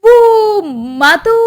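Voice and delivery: falter, caught out; stammering wife. Acted. Wife caught by husband's question, faltering.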